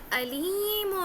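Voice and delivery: علیم drawn out with a plain a instead of ain; mistaken hamza for ain. Shown as a demonstration. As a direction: stretch علیم slowly, no throat squeeze at the start. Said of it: "عین نہیں پڑھا".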